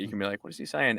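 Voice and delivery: ordinary talk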